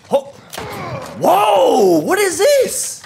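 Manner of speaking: startled cry. Real, not acted. Sudden surprise in game.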